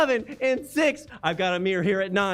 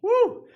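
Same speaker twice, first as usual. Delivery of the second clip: excited woo; 12 semitones higher, 4 dB louder than usual. Real, not acted. Cheers a bid.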